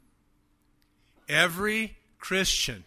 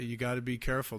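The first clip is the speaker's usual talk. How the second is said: ordinary talk, nothing marked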